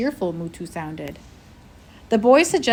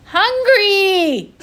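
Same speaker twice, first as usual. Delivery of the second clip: high, loud wail; 13 semitones higher, 9 dB louder than usual. A narrator's reading. The child wails in the dark to scare the boys, loud high wail. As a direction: wail it out like a starving ghost. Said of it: "you wailed again".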